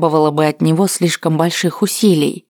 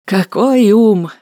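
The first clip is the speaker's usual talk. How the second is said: slow, raised, admiring exclamation; sincere admiration; 4 semitones higher, 3 dB louder, slower than usual. A narrator's reading. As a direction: marvel at him slowly. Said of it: "с искренним восхищением произнёс Веретенников". (искреннее восхищение)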